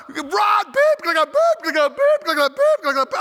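loud, high bleeped-out yelling at a player; imitating a cursing coach. Coach yelling bleeped curses at Rod who ran the wrong way, loud and high. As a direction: bark the censored curses in bursts.

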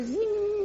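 high buzzy zing like a small saw; playful sound effect of an electric chainsaw; mock chainsaw zing. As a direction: imitate the saw whining to life.

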